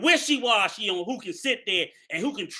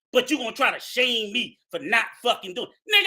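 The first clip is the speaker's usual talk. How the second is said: ordinary talk, nothing marked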